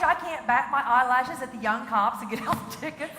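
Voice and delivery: ordinary talk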